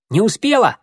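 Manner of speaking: high, loud boast; loud and proud. A narrator's reading. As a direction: call it out proudly. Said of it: "громко ответил Горелый".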